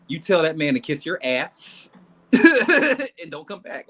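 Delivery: slow, drawn-out sass; emphatic, laughing. Real, not acted. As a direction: tell him off, slowly and firmly. Emphatic advice to client.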